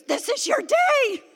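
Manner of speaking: high, slow, excited announcement; excited friend. Retold or imitated. Friend at church, announcing the big day, excited. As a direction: friend telling her this is her day.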